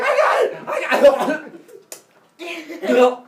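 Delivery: very high, loud mock yell; calling the catch. Kronos claiming the falling baby, high excited shout. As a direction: shout it like an outfielder, stretching the repeat.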